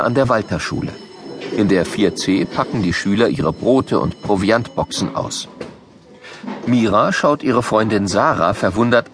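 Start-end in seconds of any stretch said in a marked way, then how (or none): none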